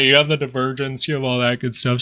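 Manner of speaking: words stretched through a yawn. Real, not acted. Tired trader yawning mid-chart-explanation to viewers. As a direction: talk through a real yawn. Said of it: "Sorry, I'm yawning".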